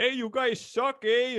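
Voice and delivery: put-on high, loud northern hockey-fan accent. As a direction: heckle in a drawn-out, high-pitched northern accent. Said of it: "hearing the northern accent".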